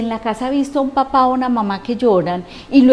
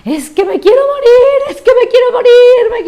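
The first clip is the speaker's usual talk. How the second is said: much higher, louder mock cry of a hopeless youth; despairing young person; 12 semitones higher, 8 dB louder than usual. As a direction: play a desperate teenager wailing it out. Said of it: "si alguien se me acerca y me dice".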